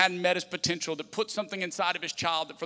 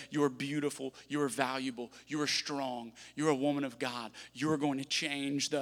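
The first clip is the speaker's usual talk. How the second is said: tender blessing; 7 dB quieter than usual. Retold or imitated. Father blessing daughter at bedtime.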